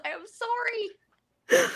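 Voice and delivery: high, drawn-out mock sob; mock crying. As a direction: wail the apology in fake tears. Feeling bad walking away.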